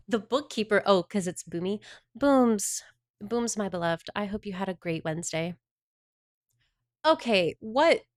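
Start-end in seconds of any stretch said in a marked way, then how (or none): none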